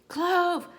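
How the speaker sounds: high, drawn call of a name; answering call. A narrator's reading. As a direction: call back to someone far away, pitched high. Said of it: "I hear Cato's answer, but he's too far away".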